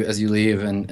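usual speaking voice

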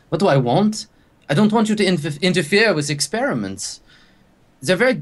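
a rattled scientist sputtering; lightly voiced flustered lab scientist.

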